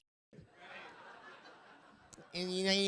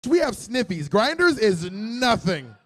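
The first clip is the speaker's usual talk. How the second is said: sassy drag queen; 4 semitones higher, 6 dB louder than usual. Retold or imitated. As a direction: brag it like a diva. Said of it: "They're, they're like".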